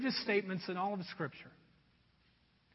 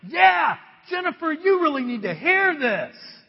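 loud, high eager cheer; pumped-up shout; 6 semitones higher, 8 dB louder than usual. Retold or imitated. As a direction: shout it like you're fired up. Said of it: "getting pumped up".

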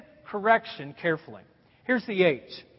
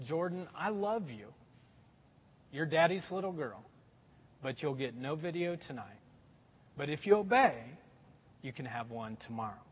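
soft, low, careful fatherly correction; a dad gently correcting his daughter; gentle correction; 6 semitones lower, 10 dB quieter than usual. Retold or imitated. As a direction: correct her quietly and lovingly. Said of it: "correcting, you know, carefully".

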